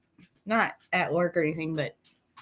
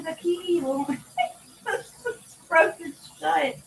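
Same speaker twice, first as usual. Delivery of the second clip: laughing; 9 semitones higher, slower than usual. Real, not acted. Laughing at frozen lock.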